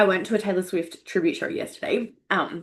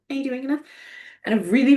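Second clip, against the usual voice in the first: ordinary talk, nothing marked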